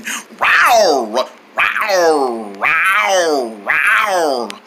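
low, drawn-out rawrs; tiger imitation. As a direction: act like a tiger, roaring. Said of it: "I act like one".

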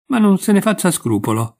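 lifted, high-pitched quick reassurance; mildly excited protest; exclamation. A narrator's reading. Colli brushes off her scruple, exclaiming. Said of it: "esclamò il Colli".

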